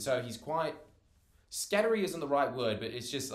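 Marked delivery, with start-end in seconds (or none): none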